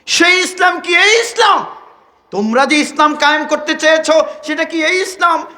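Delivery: high, insistent preacher's rendering of her question; impassioned questioning. Retold or imitated. Woman journalist demanding an answer from Taliban, exhilarated. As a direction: press the question fervently. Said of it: "উচ্ছ্বসিত হয়ে বলছে".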